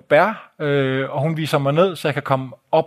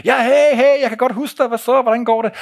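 much higher, louder, delighted to hear from him; bright burst of recognition, pitched way up; excited high greeting; 9 semitones higher, 4 dB louder than usual. Acted. Omar recognises the caller on the phone, excited and high.

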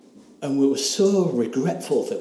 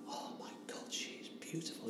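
hushed, breathless whisper of wonder; a soft whispered gasp of awe; awed whisper; 5 semitones higher, 20 dB quieter than usual. Retold or imitated. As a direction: whisper it as if stunned by her beauty. Awestruck at the girl in dream.

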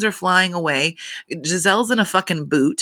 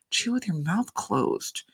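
soft, slow, calm correction; quietly telling mom to chew with her mouth closed, calm and slow; 7 dB quieter, slower than usual. Retold or imitated. Daughter scolding her mother, calmly hushed. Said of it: "calmly say".

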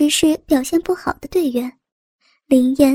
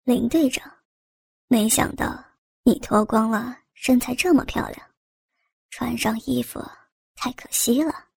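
teasing; 2 semitones lower, 6 dB quieter than usual. A narrator's reading. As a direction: tease quietly under the breath. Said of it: "高斌调笑道".

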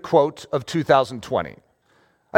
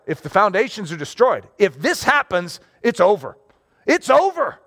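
bait voice. Acted. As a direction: play the lure, then spring the panic. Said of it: "this is a bait voice".

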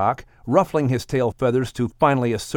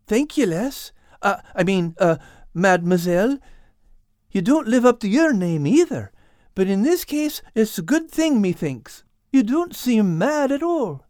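put-on a touch higher, grateful young fellow; lightly voiced Al; 8 semitones higher than usual. Al thanking the cat, lightly voiced and grateful. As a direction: play Al, thankful and shy. Al character speaks.

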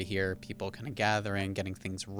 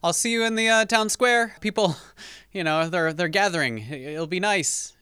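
calling out; 10 semitones higher, 10 dB louder than usual. Acted. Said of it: "he calls out to you from the bottom stairs".

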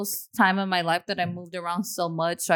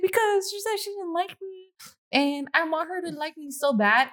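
put-on whiny crying kid; 10 semitones higher than usual. Retelling crying to her dad.

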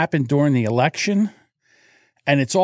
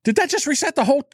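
high disbelieving question; incredulous question; 9 semitones higher, faster than usual. Retold or imitated. Asking tech support in higher disbelief. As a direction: ask in shock if it all reset. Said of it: "I go".

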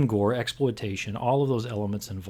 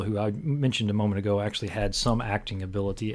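ordinary talk

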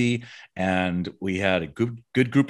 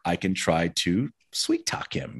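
whisper; 5 semitones lower, 5 dB quieter than usual. Retold or imitated. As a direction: lean in and whisper it, plotting. Conspiratorial aside to colleague.

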